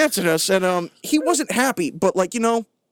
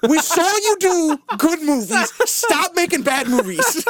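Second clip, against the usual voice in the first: pleading yell; 6 semitones higher, slower than usual. Real, not acted. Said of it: "Stop yelling at him".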